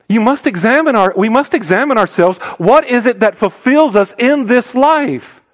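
high-pitched, pleading exhortation; urgent preaching. Real, not acted.

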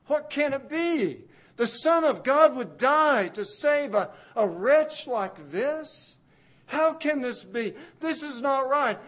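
high and loud, outraged disbelief; Satan and sin protesting in shock, high and loud; stunned disbelief. Acted. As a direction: cry out that it cannot be.

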